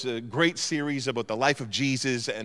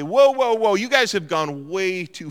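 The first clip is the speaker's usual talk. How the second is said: higher, louder whoa whoa, easing off; mock protest; 5 semitones higher, 5 dB louder than usual. House owner protesting the roof diggers, mock alarm. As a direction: throw up your hands at the intruders.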